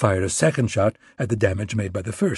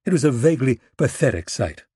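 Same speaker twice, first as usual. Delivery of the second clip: plain reading voice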